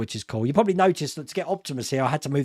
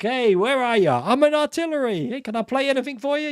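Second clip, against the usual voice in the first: shouted movie quote; 8 semitones higher than usual. Retold or imitated. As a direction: yell it like a DJ over gunfire, half-joking. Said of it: "where it's like".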